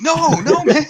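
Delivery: high, loud mock protest; exasperated denial. Insisting to doubters it is not an Italian, exasperated. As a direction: protest with the pitch shooting up.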